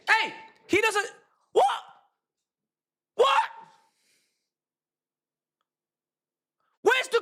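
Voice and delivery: high-pitched, outraged yelling; furious rant. Real, not acted. Fan accuses the other side of cheating, furious rant. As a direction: rail at them in real indignation.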